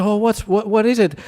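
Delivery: high, lightly voiced asking; mock-puzzled high query; curious attendants. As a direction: play the puzzled heavenly staff.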